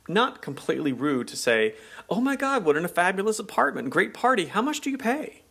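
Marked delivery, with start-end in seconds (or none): 2.1-5.4 gushing party guest. Pretend. Gushing New Yorker at a party.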